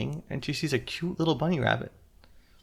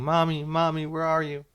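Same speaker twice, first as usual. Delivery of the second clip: higher, plaintive call for mother; crying out; 5 semitones higher than usual. A narrator's reading. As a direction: act out a lost child's cry. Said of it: "she's just crying".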